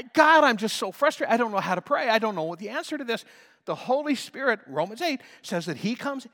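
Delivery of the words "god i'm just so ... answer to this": frustrated prayer. Retold or imitated.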